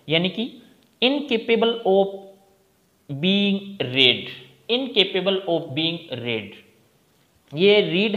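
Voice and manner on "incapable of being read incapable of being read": very slow, word by word; slow dictation. Shown as a demonstration. Teacher dictating the meaning to students, very slow. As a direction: dictate each word clearly. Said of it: "teacher dictating definition".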